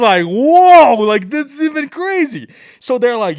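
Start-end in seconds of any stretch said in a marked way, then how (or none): 0.2-2.5 high, loud, thrilled whoa; loud, high thrilled outburst; excited amazement. Retold or imitated. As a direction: gush like a kid shown a helicopter. Amazed by the helicopter offer.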